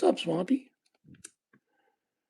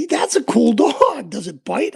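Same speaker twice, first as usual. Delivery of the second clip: put-on kid voice; 6 semitones higher, 8 dB louder than usual. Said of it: "And he's like".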